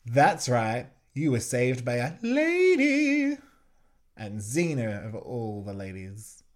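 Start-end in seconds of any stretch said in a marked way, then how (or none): 0.1-3.4 loud gloat rising high on a drawn-out "lady"; mock triumphant crowing. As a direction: crow it loudly, stretching the end up high. Voicing Xena gloating after a rescue.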